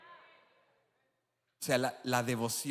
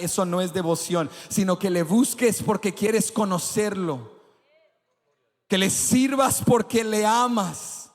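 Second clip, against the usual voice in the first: rising to full preaching force, louder and higher; 5 semitones higher, 7 dB louder than usual. Acted. Preacher building up.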